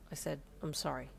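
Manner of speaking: flat, emotionless apology; monotone. Acted. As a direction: say it without any feeling. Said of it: "still in a monotone voice".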